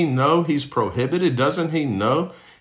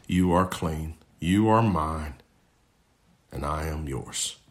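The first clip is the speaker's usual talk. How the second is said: tender reassurance; 7 semitones lower, 5 dB quieter than usual. Acted. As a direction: lower and softer, fading almost to nothing. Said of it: "the right one said".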